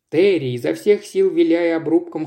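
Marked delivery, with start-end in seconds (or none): none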